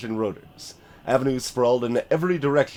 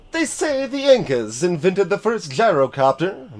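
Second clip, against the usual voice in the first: a hint of a proud pilot, higher; boasting pilot; 6 semitones higher, 4 dB louder than usual. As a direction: boast lightly in a raised voice. Pilot boasting, voiced character.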